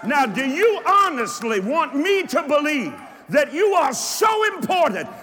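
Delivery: high, loud declamatory shout; full-force preaching. Real, not acted. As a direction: proclaim it to a roused congregation in driving phrases.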